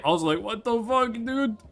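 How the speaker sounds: normal talking voice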